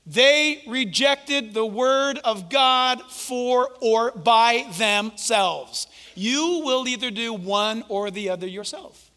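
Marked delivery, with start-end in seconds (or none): 0.1-5.9 drawn-out, each word weighed; slow right down for emphasis. Real, not acted. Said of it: "slow emphatic".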